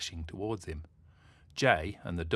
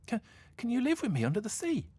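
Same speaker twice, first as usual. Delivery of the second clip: eager; 4 dB louder, faster than usual. Acted. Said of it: "asked the mermaid eagerly".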